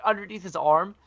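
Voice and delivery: ordinary talk, nothing marked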